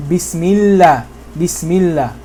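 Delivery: "Bismillah" with light l and a as in "cat"; thin lam. Shown as a demonstration. Teacher giving the kasra example, thin lam. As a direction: keep the last syllable of "Bismillah" light and fronted. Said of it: "then you make it thin".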